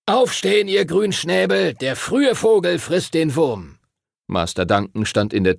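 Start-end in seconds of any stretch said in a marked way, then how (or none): 0.1-3.8 high, bright wake-up call of a brisk teacher; cheerful teacher calling from the doorway; teacher waking the boys. Acted. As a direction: rouse the sleepy boys like a drill master. Teacher wakes up the pupils.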